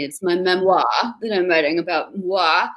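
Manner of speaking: "memoir" as "mem-WAH", rhymed with "moi"; French open "wah" ending on "memoir" and "moi"; mock-French memoir. Shown as a demonstration. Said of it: "that's how you should always say it".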